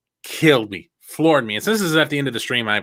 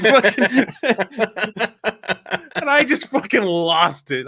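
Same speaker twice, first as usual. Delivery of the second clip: ordinary talk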